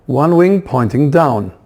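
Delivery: ordinary delivery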